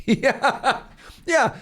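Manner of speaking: high laughing yeah yeah. Real, not acted. Streamer laughing at chat's joke, high laughing. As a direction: laugh through the words, delighted.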